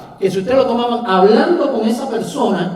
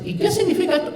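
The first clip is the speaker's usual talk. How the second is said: much higher, curious questioning voice; curious stranger asking; 11 semitones higher, faster than usual. Retold or imitated. A curious stranger asks the preacher about the sticker, in a much higher, intrigued voice. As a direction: play the nosy passer-by asking.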